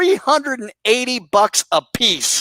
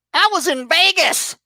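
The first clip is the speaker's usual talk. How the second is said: mocking imitation; 7 semitones higher than usual. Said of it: "You said".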